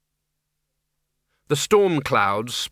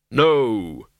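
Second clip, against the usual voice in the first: grim reaper voice; 3 dB louder than usual. Acted. Death refuses.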